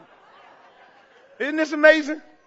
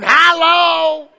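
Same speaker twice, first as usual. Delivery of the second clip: high, loud drawn-out Hollow; playful imitation of a loud cry; imitating a holler; 5 semitones higher, 9 dB louder than usual. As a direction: holler it like a church lady. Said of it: "We in heaven still crying".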